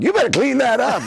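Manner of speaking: high, loud mock scold; tough Newark staffer. Comedian mimicking a Newark gate agent, high loud mock scold. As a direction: bark the order like a tough Newark worker. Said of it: "In Newark, they're like".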